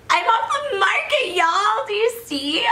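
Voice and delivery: bright high thrill in the voice; squealing the big news to viewers; excited. Real, not acted. Bride-to-be telling viewers she's engaged, excited and high.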